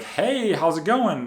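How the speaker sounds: high-energy greeting. Acted. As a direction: act out an energetic caller saying hello. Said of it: "open the call with a lot of energy".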